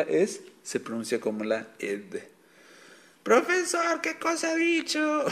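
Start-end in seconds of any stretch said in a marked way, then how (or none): 3.2-5.2 high, squeaky, bewildered student voice; confused student. Pretend. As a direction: play a lost pupil begging for clarification. (alumno confundido con voz aguda y chillona)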